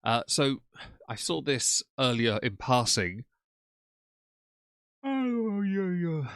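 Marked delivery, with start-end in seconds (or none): 5.0-6.3 high yawning oh, trailing down. Real, not acted. Streamer yawning while reading a post to chat, words stretched. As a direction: yawn right through the words. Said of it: "Sorry about the yawn".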